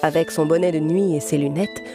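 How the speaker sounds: ordinary delivery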